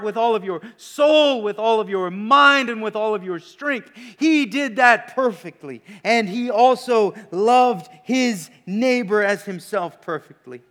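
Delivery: loud, rising preacher's proclamation; preaching at full force. Acted. Preacher to congregation, proclaiming with full force. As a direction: drive home how perfectly he kept it. Preacher building on Jesus' perfect obedience.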